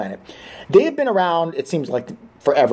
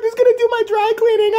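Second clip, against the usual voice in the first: much higher mock-panicked shriek; mock girlfriend. Joking about losing the dry cleaner, a high mocking shriek. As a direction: squeal it in a high put-on girlish voice.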